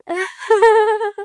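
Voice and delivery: a high laugh that almost sounds like crying; laughing at wrong time. Acted. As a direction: giggle that cracks into a sob. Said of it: "तू हंस रही है". (ग़लत समय पर हँसी, रोने जैसी)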